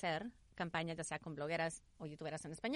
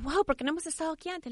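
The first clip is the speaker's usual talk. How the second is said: excited quote; 9 semitones higher, 10 dB louder than usual. Retold or imitated. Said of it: "me decían".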